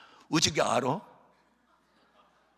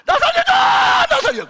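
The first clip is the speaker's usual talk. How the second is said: much higher, louder cry with laughter; terrified scream; 12 dB louder, slower than usual. Retold or imitated. Father screaming to be saved from the vision, mock yell. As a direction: shriek for rescue, half laughing.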